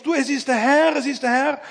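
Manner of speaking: high, urgent repeated announcement; imitated eager discovery; excited recognition. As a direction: urge a friend on, pitched well up. John excitedly tells Peter, acted out.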